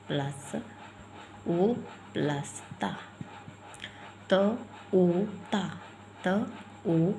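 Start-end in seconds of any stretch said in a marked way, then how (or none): none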